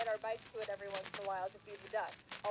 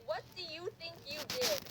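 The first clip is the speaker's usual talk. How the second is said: higher-pitched mock parent question; mocking echo, pitched up; 6 semitones higher than usual.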